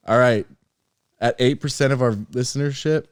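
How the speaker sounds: ordinary talk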